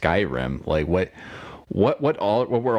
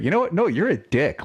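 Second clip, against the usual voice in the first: mock tell-off; 11 semitones higher than usual. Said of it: "tell somebody off and be like".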